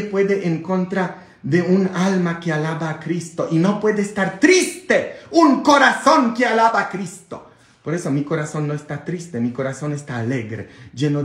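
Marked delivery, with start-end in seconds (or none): 3.5-7.5 high, shouted proclamation; fervent preaching. Real, not acted. Priest preaching to viewers about joy in Christ, fervent.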